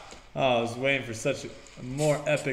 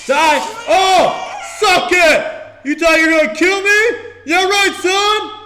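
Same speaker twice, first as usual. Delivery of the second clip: very high, loud gloating shouts; triumphant yelling; 12 dB louder, slower than usual. Real, not acted. Gamer killing the assassin, yelling in triumph. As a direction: scream it as you win the fight.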